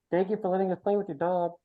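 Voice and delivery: high, lightly voiced little girl; a small girl saying thanks sweetly; little girl Belly. Little Belly thanks Mel for the dog, lightly voiced. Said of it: "Belly, who says".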